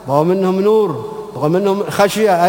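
ordinary delivery

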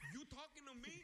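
high, loud Brooklyn tough guy; Brooklyn accent. Retold or imitated. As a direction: Brooklyn gangster line. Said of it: "a New York accent from Brooklyn".

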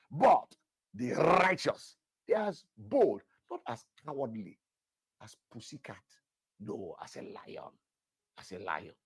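slow, bold scripture reading fading down; a touch of bold proclamation. Acted. Pastor reads Proverbs to the congregation, slow and bold then dropping. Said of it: "proclaiming scripture".

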